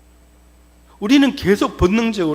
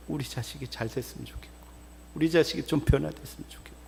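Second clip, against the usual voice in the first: soft, lowered murmur; parent's quiet wish; 3 semitones lower, 10 dB quieter, faster than usual. Acted. Parent wishing the child well, quiet and soft. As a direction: wish it softly for your child. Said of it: "막 말은 그렇게 해도 뭐예요?".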